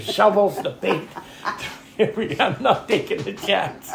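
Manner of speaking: high, laughing list; telling the pilot what was thrown out, laughing. Retold or imitated. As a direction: laugh while listing what went out. Laughing retelling of throwing everything.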